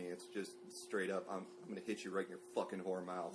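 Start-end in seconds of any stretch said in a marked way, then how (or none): 1.3-3.3 quoted threat. Retold or imitated. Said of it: "when she swears at me".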